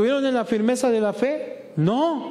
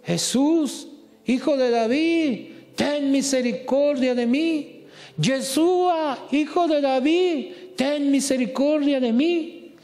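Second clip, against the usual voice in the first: crying out; 6 semitones higher than usual. Retold or imitated. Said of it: "él empezó a gritar".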